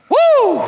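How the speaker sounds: very high, loud whoop of joy; excited whoop. Real, not acted. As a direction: let the whoop fly after the sermon. Exclamation after the reading.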